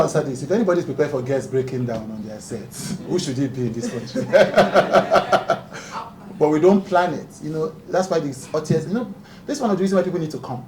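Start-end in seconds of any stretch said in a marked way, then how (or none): 4.3-6.3 breathy helpless laugh; airy laugh at the question; burst of laughter. Real, not acted. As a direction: let out a long real laugh.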